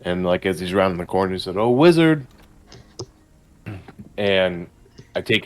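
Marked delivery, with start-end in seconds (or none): 1.6-2.2 excited call. Acted. Player character calls out greeting.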